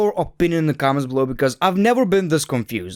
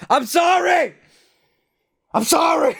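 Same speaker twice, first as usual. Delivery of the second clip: apologetic shout; 13 semitones higher than usual. Real, not acted. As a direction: yelp out a sorry. Mock apology after insulting AJ.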